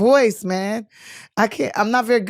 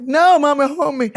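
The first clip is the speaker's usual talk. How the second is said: put-on childish plea; 6 semitones higher, 5 dB louder than usual. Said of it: "And I'm like".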